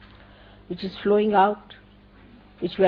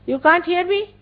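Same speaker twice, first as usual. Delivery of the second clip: yelling across the room; 7 semitones higher than usual. Real, not acted. Calling to people far away.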